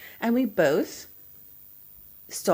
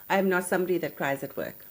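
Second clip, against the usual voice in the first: normal talking voice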